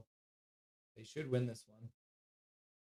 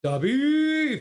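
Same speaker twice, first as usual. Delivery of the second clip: high, loud call of the name; 11 semitones higher, 8 dB louder than usual. Real, not acted. Streamer calling the dog's name loudly to chat.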